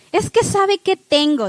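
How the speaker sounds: tossed off as the group's joke; mock quote. Preacher quoting her group's joke, lightly voiced. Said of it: "decíamos".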